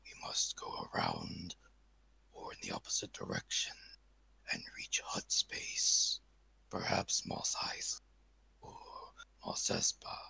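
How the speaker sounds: hushed, secretive whisper. Acted. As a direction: whisper the route urgently.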